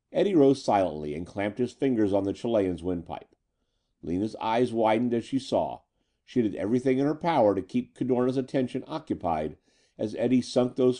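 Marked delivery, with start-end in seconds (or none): none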